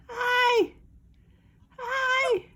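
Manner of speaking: high, bright sing-song greeting. Real, not acted. Owner greeting her pet bird in a high sing-song.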